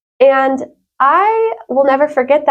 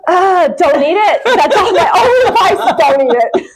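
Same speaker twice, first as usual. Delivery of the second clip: laughing warning; 8 semitones higher than usual. Real, not acted. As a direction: warn them off while cracking up. Joking warning about her cooking.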